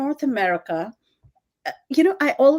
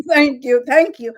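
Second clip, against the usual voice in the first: emotional thanks; 3 semitones higher, 9 dB louder than usual. Real, not acted. Moved thanks after the praise.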